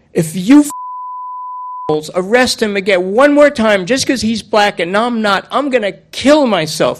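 put-on high, loud child's tirade; furious outburst. Kid berating the cop who keeps arresting his friend, furious outburst.

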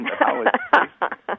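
how is it tasting? rising sharply on it in mock disbelief; high mock-incredulous how is it tasting?; stressed disbelief. Shown as a demonstration. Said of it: "how does it taste is fine".

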